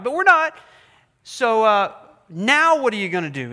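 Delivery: hammed-up exasperation at God; mock exasperated plea. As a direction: throw the question at God in frustration. Voicing the people reminding God.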